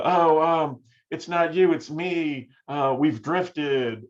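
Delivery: slow, awkward student breakup voicemail, lightly voiced. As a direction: fumble through a breakup message. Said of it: "You get a lot of".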